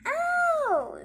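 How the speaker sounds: high pained yelp; ouch. Acted. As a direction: yelp at a sudden hurt. Frog jumps on pig's head.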